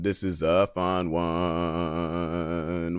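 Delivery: This is a fun one, each word dragged very slowly with a processed echo; stretched, drawn-out repeat. Acted. Reading a meme title aloud to chat, then a slowed, warped echo of it.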